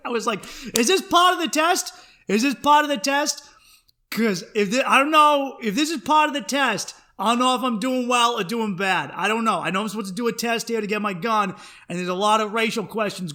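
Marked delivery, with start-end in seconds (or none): 0.7-9.1 loud, high-pitched exasperated questioning; incredulous outburst. Retold or imitated. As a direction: raise your voice, baffled and worked up. Mock outrage at cop's odd questions.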